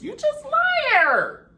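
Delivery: high, loud, stretched-out accusation; outraged shout. Real, not acted. Vlogger calling the husband a liar, loud shout. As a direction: yell it at the liar.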